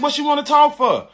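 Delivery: high, puzzled "what she wanna talk for"; incredulous. Retold or imitated. Groom questioning the bridesmaid, pitched high in disbelief. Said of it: "I said".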